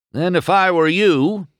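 high, loud retort; shooting back. A narrator's reading. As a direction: fire back sharply. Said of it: "Doc shot back".

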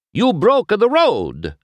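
high, loud, slow scolding; Luigi scolding. A narrator's reading. Small Italian import blaming McQueen for the road, scolding. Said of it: "scolded the small Italian import named Luigi".

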